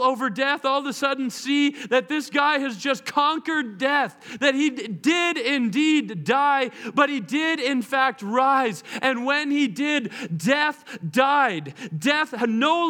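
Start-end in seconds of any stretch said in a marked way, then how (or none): none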